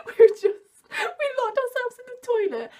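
real high, loud laughter through the words; laughing while talking. Real, not acted. Telling how the two friends hid in the toilet, laughing while talking. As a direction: crack up as you tell it.

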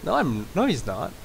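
flustered, hesitant denial; nervous bad liar. Real, not acted. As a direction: deny it badly, caught out. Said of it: "Worst liar ever!".

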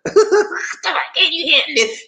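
high, laughing tease of the sales pitch; flirty saleslady repeat. Retold or imitated. Saleslady teasing buyer about the Audi, high and playful. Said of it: "Get your mind out the gutter".

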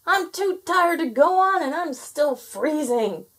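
high-pitched wail, slowing at the end; screamed complaint. A narrator's reading. Freezing gnome too tired to go on, screaming. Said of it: "he screamed".

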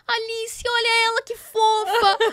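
imitated fond gushing; cooing. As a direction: call someone over to see a cutie. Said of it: "Daí ela".

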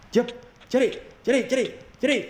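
high, frantic chant; excited battle cry. Real, not acted. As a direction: scream at the enemy to die. Fighting enemy in game.

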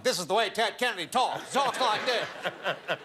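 put-on high, overblown Kennedy accent, last words stretched out; mistaught Ted Kennedy accent. Actor going around practising the wrong accent, hammed-up Kennedy voice. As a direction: parade the absurd upper-crust Boston accent. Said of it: "I was going around going".

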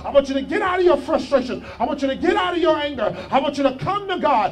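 loud, high, driving altar call; full-force preaching. Acted. Pastor urging sinners to step into grace, preaching loud and high. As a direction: preach it hard, pushing them to come now.

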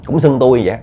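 ordinary delivery, nothing marked

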